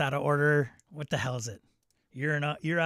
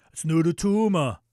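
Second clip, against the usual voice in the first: put-on Arnold accent; 3 semitones higher, 7 dB louder than usual. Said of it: "[name] says".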